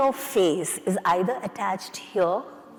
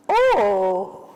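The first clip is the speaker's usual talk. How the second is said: long oh demo; long dropped oh. Shown as a demonstration. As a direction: drop the jaw into a long oh. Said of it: "a nice long O".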